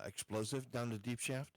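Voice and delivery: normal talking voice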